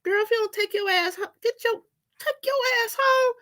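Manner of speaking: high, stern mock uncle scolding his niece. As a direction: bark it like an uncle ordering her home. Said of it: "my uncle".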